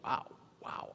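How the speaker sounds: breathy whispered wow, wow; whispered wow effect. Pretend. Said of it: "I do a wow sound effect".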